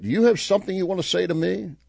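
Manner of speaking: woman challenging the parrot, pointed; confrontational customer. Acted. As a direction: get right in its face. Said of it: "when she asked the parrot".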